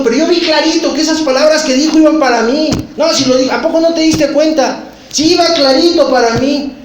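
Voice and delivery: high, loud, worked-up insistence; agitated insisting. Retold or imitated. Suspicious person insisting to a friend, high and agitated. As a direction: insist loudly that the words were aimed at you.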